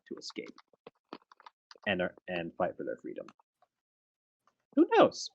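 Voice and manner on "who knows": a theatrical high-pitched shrug; dramatic high. Pretend. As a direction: squeak it out, very high. Mysterious rhetorical question.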